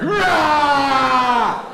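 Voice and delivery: loud high shouted roar; loud comic bear roar. Acted. Bear grabs fish.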